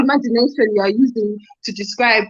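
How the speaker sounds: quick, lightly put-on Nigerian accent; mock Nigerian accent. Nigerian accent as a fed-up parent scolding, fast. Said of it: "That's good".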